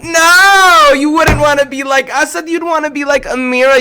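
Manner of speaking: high, loud insistent no; emphatic correction. Real, not acted. Teacher correcting kids, loud and insistent.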